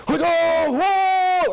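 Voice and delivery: high, loud triumphant whoop; big, drawn-out "whoa" yell; celebrating shout. Retold or imitated. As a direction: roar it like a fired-up goalie after a save. Goalie's celebrating shout acted out.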